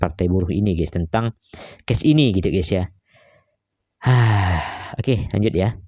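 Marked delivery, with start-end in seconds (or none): 4.0-4.9 long exhaled sigh, falling; weary sigh. Real, not acted. YouTuber about to resume the clip, weary sigh. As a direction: breathe out heavily before carrying on.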